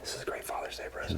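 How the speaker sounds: hushed aside to the camera; telling the wife watching, whispered. Acted.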